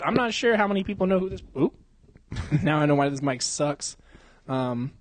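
ordinary talk

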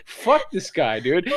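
high-pitched mock outrage from locals; offended Indiana locals. Host voicing Indiana listeners, mock offended. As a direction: play an indignant local griping about the host. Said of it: "All the people in Indiana are like".

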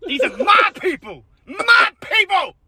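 high, slowing to a proud shout; mock triumphant shout. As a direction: crow over your people.

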